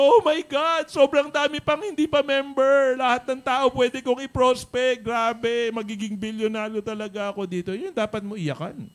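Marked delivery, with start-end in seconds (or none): 0.0-7.7 much higher, much louder, ecstatic cry; building to an ecstatic loud cry, then easing off; excited crying out. Retold or imitated. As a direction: shriek with joy over all the prospects. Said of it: "ang iniiyakan mo".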